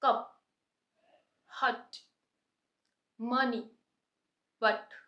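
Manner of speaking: कप हट मनी बट, each short a clear and punched loud; sharp short a. Shown as a demonstration. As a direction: bark out कप हट मनी बट with a crisp short a. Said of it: "थोड़ा sharp आ रहा है".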